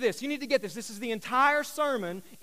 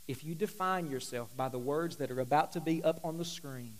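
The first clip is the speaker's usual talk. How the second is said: normal talking voice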